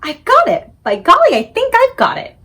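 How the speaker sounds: loud, high, rising with joy; excited. Acted. Sophie bursts out with her idea, loud and excited. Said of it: "in a voice filled with excitement".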